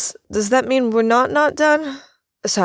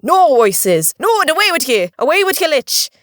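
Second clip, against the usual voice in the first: higher, louder Irish refusal; indignant leprechaun; 8 semitones higher, 5 dB louder than usual. Acted. Leprechaun banishes the begging lich, indignant Irish cry. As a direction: send her packing in a brogue.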